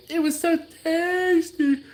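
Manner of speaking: high mock Trinidadian voice of the kid taster; Trinidadian accent imitation. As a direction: ham up a Trinidadian child's delight. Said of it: "I do a horrible Trinidadian accent".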